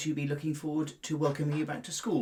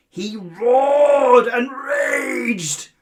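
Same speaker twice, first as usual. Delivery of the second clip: dramatic roar; 8 semitones higher, 14 dB louder, much slower than usual. A narrator's reading. Lion trapped in net.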